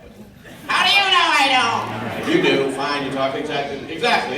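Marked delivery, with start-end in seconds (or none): 0.7-1.9 snooty fan voice. Retold or imitated. Answers back in that voice.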